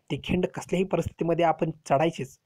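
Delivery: ordinary talk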